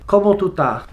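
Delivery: "¿Cómo tú estás?" said Caribbean style, the s sounds dropped, pitched a little up; Caribbean Spanish. Shown as a demonstration. Visitor showing how Puerto Ricans greet, in a light Caribbean accent. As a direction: drop the s's, Caribbean lilt. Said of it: "In Puerto Rico or Dominican, they'd say".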